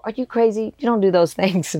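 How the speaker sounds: high, incredulous self-quote to her sister; mock disbelief. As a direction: playfully ask are you crazy. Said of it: "I go".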